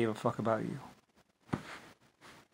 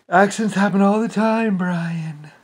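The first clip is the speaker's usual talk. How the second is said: high, louder mocking menace; mock threat; 7 semitones higher, 5 dB louder, slower than usual. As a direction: threaten sweetly in a put-on voice. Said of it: "Rick Bush".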